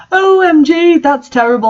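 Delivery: high, loud gasp of horror; a girl hearing terrible news; gasping shock. A narrator's reading. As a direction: blurt it out in shock. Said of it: "I gasped".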